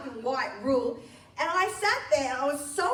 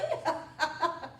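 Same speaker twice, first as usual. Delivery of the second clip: real laugh; 4 semitones higher than usual. Real, not acted. Laughs at punchline.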